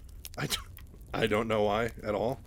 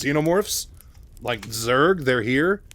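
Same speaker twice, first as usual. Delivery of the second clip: alarmed surprise; 3 semitones higher, 6 dB louder, slower than usual. Retold or imitated. Mike alarmed at xenomorph reports.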